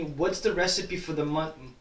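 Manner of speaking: ordinary talk, nothing marked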